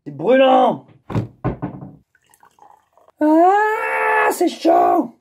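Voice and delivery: crier fort que ça brûle; cri de douleur. Real, not acted. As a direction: hurlement de brûlure, voix haute. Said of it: "je suis en train de me cramer". (crier qu'on se brûle)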